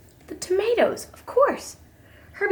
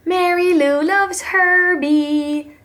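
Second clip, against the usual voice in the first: slow, sing-song, much higher tease; teasing; 6 semitones higher, much slower than usual. A narrator's reading. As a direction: drag it out to tease a friend. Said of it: "teased Jethro".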